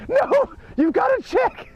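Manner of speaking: high-pitched hammed-up hysterical lady; frantic drunk lady. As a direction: squeal it like a drunk lady losing it. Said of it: "She was just like".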